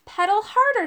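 high-pitched call to push on; urgent shout. A narrator's reading. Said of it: "shouted Arthur".

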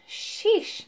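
muttered. A narrator's reading. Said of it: "muttered Urk".

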